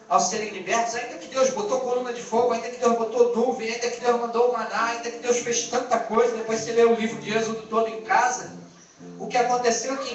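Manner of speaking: usual speaking voice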